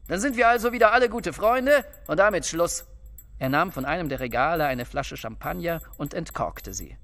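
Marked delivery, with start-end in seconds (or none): 0.1-2.8 higher, hearty and blunt; brusque heartiness. A narrator's reading. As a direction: briskly declare everyone friends. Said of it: "gewohnte Manier brüsker Herzlichkeit".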